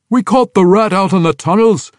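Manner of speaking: high, loud gruff dwarf; matter-of-fact old soldier; old dwarf reporting the catch; grizzled dwarf veteran. Acted.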